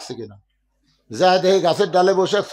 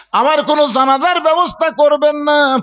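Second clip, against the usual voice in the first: high, raised-voice plea; impassioned cry; 10 semitones higher, 6 dB louder than usual. Acted. Fatema forbidding her funeral prayer, impassioned cry. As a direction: cry it out from the pulpit at a high pitch. Same request repeated at full force.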